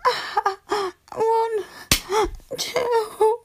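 tearful counting. Acted. Counting after spanking, shaky.

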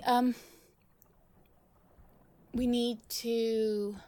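ordinary talk, nothing marked

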